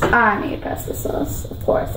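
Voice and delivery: whispered repeat to herself; whispered aside. Real, not acted. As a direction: whisper it to yourself.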